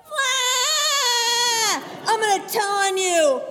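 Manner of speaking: high, loud fake wail then threat; mock wailing. Little brother wailing at his sister, loud mock cry. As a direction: wail like a tattling kid.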